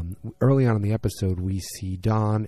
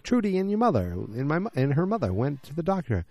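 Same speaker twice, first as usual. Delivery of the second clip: excited; 6 semitones higher than usual. Retold or imitated. Father-in-law quoted, excited.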